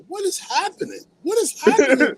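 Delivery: high, squealing disbelief; a hammed-up what is happening; mock shock. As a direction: act floored by who's calling. Acting out a shocked call.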